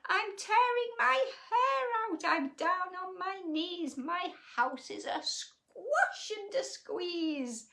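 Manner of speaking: put-on high, desperate old lady; tearing her hair out, frantic; old lady crying out. A narrator's reading. Old lady at her wits' end with the animals, high and frantic. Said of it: "The little old lady cried".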